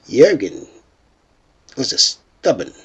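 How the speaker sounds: ordinary talk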